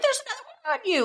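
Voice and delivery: high and fast, frantic; mock panic. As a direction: blurt it out fast in a fake panic.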